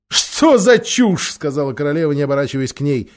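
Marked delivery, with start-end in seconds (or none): 0.1-1.3 high, slow scoff; scornful. A narrator's reading. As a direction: sneer it down at a child. Queen dismisses Polly.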